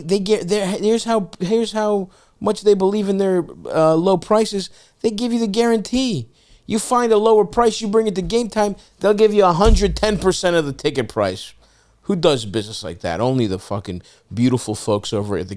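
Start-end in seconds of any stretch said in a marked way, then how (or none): 0.0-11.5 pitched-up, pushy sales pitch; hyped ad read. Acted. Host pitching the ticket app to listeners, upbeat ad voice. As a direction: sell the guarantee like an announcer.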